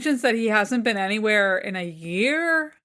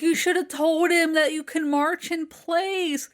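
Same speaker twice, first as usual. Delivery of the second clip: imitating the mom; 7 semitones higher than usual. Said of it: "his mom's like".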